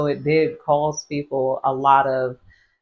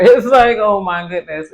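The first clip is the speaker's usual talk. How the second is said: laughing; 5 semitones higher, 9 dB louder than usual. Real, not acted. Delighted about the Netherlands.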